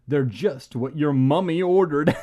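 loud, punchy slogan; commercial pitchman. A narrator's reading. Actor reciting his bandage ad, pitchman voice. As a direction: land the pun like an ad tagline.

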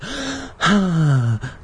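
long, weary sigh. A narrator's reading. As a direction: breathe out long and defeated. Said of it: "I let out a long sigh".

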